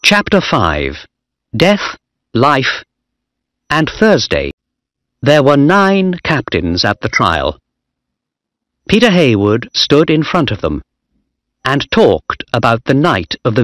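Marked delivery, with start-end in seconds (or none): none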